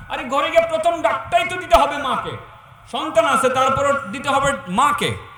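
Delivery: loud, high scolding from the pulpit; loud rebuke. Acted. Preacher scolding sons who forget to greet their mother first, loud rebuke. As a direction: hammer the point home, slowing at the end.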